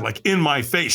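ordinary delivery

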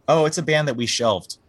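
a hint of a smug label exec; mock label guy. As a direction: play the casual record label guy, mildly mocking.

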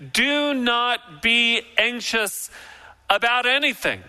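slow, emphatic, each word weighted; "Do not be anxious" spoken slowly with weight on "not"; firm command. Shown as a demonstration. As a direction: lay it down as God's order. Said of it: "And what's the command?".

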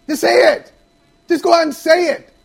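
insistent shout. Real, not acted. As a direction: push him hard to say it. Pushing co-host to admit.

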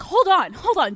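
loud, high, hurried interjection; frantic halt. Retold or imitated. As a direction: stop someone in a retold panic. Retold frantic order to stop.

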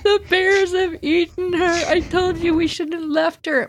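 put-on high, upset child's wail; a hint of a panicked sister's sob; crying sister. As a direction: sister crying that bears ate her. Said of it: "my sister starts crying".

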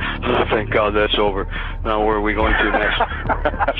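slow, wheezy mock exhaustion; huffing and puffing. Old fogey rocker joking about coming offstage, mock wheezing. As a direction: puff it out like a worn-out old fogey. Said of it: "huffing and puffing and wheezing and going".

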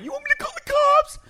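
high wailing mock sob; mock crying woman. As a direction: whine it like a crying lady. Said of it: "She starts crying".

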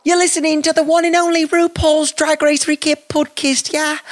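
high-pitched Australian show intro; Australian accent. Acted. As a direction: announce it in a put-on, high Aussie voice. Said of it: "she's in Australia".

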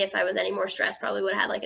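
ordinary delivery

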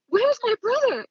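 high-pitched mock yell; retold yelling. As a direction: re-enact yelling at him, voice shooting up. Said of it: "just yelling at him".

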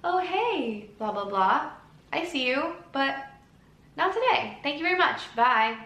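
high, sing-out greeting and dismissal; polite brush-off. Acted. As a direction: politely wave off an unwanted caller. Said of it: "you'd be like".

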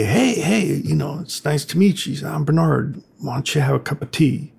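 a hint of an eager host offering tea; mild put-on welcoming voice; friendly Bernard. Voicing homeless man Bernard.

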